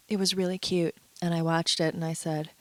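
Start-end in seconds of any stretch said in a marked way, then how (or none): none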